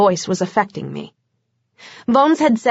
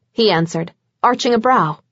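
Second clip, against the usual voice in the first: usual speaking voice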